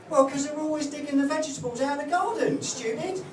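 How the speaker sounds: put-on high kid's voice, cheeky and know-it-all; cheeky child. Son correcting his dad, high cheeky child voice. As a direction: talk down to Dad like a smug five-year-old. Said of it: "He was literally like that".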